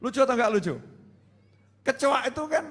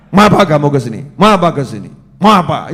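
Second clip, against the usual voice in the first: loud, stern and commanding; gagah; 4 semitones lower, 13 dB louder than usual. Shown as a demonstration. Demo of how to face an enemy, loud commanding challenge. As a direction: demand it with full authority. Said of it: "Ngadepi musuh tuh yang gagah".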